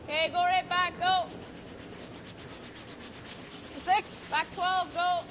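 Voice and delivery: curling skip yelling. Real, not acted. Skip calling sweep from house.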